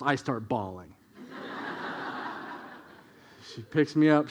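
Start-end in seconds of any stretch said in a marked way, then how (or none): 1.3-2.6 quiet breathy chuckle; soft laugh. Real, not acted. Preacher amused at his childhood bawling, quiet breathy chuckle. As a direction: laugh under the breath at the memory.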